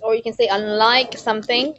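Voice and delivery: ordinary delivery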